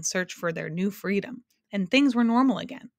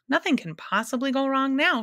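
crowing with careless cheer; happy cry; 7 semitones higher than usual. A narrator's reading. The Humbug to his friends at the shore, happily exclaiming. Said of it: "cried the Humbug happily".

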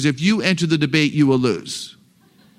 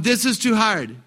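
higher, lightly voiced complaining child; playing the kid; 5 semitones higher than usual. Kid complaining to a parent about schoolwork, childlike protest. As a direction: play a kid balking at the work. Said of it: "I'm the kid".

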